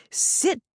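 high "Sit" with a separated T; clearly pronounced T. Retold or imitated. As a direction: mimic the dog command "Sit", popping its T. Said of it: "pronouncing their T's clearly".

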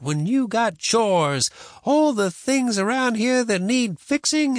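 high, indignant grandma, drawing out key words; scolding grandma. Acted. Grandma scolding boy for skipping chores, indignant.

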